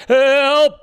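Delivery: high, loud cry for help; frightened cry. Acted. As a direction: yelp it out in fright. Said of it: "he croaked".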